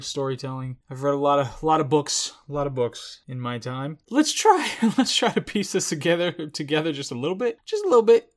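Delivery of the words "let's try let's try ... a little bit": higher, louder, giggly try at making sense; chuckling at own confusion; laughing through words. Real, not acted. Viewer laughing while piecing the film together, giggly.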